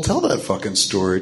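ordinary talk, nothing marked

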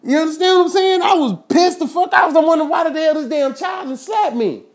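pissed-off ranting to the audience; angry shout. Real, not acted. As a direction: keep yelling, outraged. Still raging over the slap.

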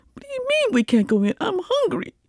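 put-on high indignant kid voice; angry little girl. Angry ten-year-old girl protesting to her daddy, high and indignant.

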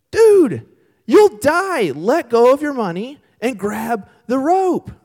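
loud, high, urgent yelling; exasperated rescuer's shout. Acted. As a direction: shout at someone who won't listen. Rescuer urges drowning man.

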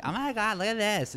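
put-on high-pitched Valley girl gush; California girl. Tourist girl gawking at the chapel, California girl voice. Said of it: "like, a California girl".

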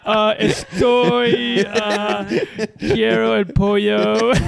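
very slow, halting beginner Spanish; a joking stumble through Spanish; fumbling Spanish. Pretend. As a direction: grope for each Spanish word. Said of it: "Fumbling through it".

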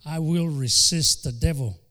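forceful preacher's declaration; preaching. Acted. Preacher rebuking the devil before the congregation, forceful.

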